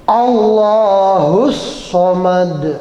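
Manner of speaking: "Allahus Somad" very slowly, with a heavy s; emphatic sod. Shown as a demonstration. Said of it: "Salah benernya udah kedengeran".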